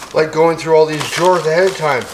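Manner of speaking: usual speaking voice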